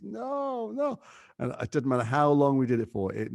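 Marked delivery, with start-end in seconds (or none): 0.0-1.0 high, laughing-voiced no, no; imitating Jackie Chan. Jackie Chan refusing the actor's line, high laughing no. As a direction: squeak a tearful-with-laughter no.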